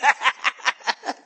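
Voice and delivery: high peal of laughter; amused laugh. Real, not acted. Pastor laughs as congregant sticks tongue out, high peal. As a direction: crack up at the reaction.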